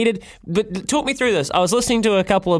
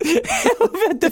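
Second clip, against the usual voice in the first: laughing as he speaks. Real, not acted. Host mocking the caller's best-food claim, laughing. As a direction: let the laugh spill into the words.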